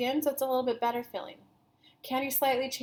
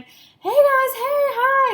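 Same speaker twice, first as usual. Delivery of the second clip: high, loud, gleeful hello to coworkers; gleeful greeting; 14 semitones higher, 11 dB louder, slower than usual. Retold or imitated. Kelly greets coworkers on payday, high and gleeful. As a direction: sing out a bright hello, much higher and louder. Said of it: "you always saw Kelly at work going".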